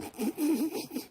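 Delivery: a short, high burst of laughter; amused laugh. Real, not acted. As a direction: laugh at the punchline. Punchline about calling them.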